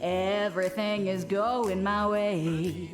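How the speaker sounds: ordinary talk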